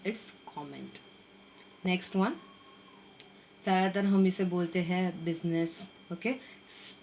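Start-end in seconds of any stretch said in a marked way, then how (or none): 0.1-1.0 comment said kom-ment, much softer and lower; model pronunciation, soft. Shown as a demonstration. Teacher answering how to pronounce comment. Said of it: "how to pronounce?".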